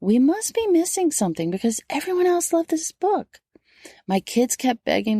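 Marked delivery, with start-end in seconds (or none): 0.0-3.4 higher, doubtful self-questioning; puzzled inner thought. Retold or imitated. Mom recalling reading a boring recommended book, puzzled. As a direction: voice her own baffled thought, pitched up. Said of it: "I kept thinking".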